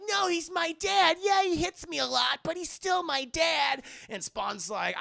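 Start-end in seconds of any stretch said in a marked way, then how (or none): 0.1-3.8 put-on whiny kid voice. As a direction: beg in a high whiny kid's voice. Zach defends his abusive dad.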